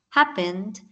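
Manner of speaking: HAP-pened like going down a slide; stress on first syllable. Shown as a demonstration. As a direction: punch HAP in happened, let the rest descend. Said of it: "como si ella iniciara grande, alta".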